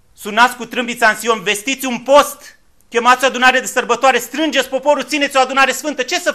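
loud, high preacher's call; fiery sermon at full volume; full-force preaching. Acted. Proclaiming the verse loudly.

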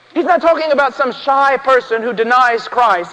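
emphatic, high-pitched sermon delivery; full-force preaching. Real, not acted. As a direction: drive each point home to the congregation, pitched high.

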